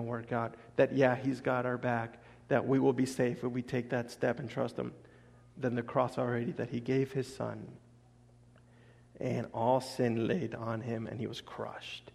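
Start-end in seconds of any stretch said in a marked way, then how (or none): 5.7-12.1 dropping to a whisper over the cross; hushed. Real, not acted. Preacher hushing the room about the crucifixion, whispering. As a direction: breathe it softly, in awe.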